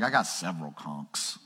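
a member sheepishly admitting it; mock congregant. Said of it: "some of you are like".

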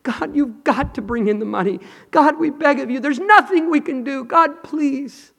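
high, pleading cry to God; desperate high prayer. Retold or imitated. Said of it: "knelt down and said".

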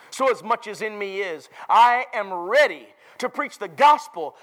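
loud, emphatic Bible reading; forceful pulpit recitation; preached scripture reading. Real, not acted. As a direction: proclaim the verses to the congregation.

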